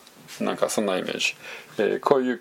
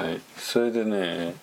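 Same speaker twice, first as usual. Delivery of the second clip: ordinary talk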